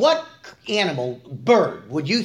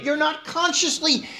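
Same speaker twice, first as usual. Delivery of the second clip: ordinary talk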